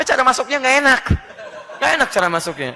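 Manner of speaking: loud, high talk through laughter; laughing. Real, not acted. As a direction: laugh along with your own joke. Preacher laughing at the joke.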